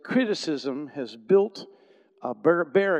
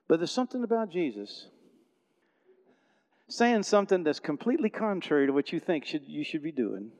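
ordinary delivery

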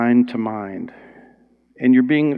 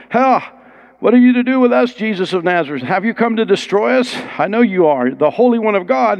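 much higher mocking demon challenge; demon crying out; 7 semitones higher than usual. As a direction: sneer it out as the unclean spirit. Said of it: "he cried out and said".

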